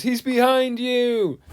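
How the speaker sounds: high, slow sing-song crowd call; pantomime crowd shout. Retold or imitated. Panto crowd warning the hero, sing-song and high. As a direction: chant it like a whole theatre of kids. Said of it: "the whole crowd goes".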